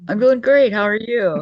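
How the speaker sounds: high loud cheerful answer; excited. Real, not acted. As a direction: beam it out, big and upbeat.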